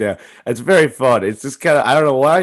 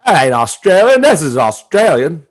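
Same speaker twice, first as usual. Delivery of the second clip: mock Australian accent; 5 semitones higher, 7 dB louder than usual. Host attempts Australian after accent remark, loud mock Australian. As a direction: overdo a broad Australian twang. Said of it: "like, Australia or overseas".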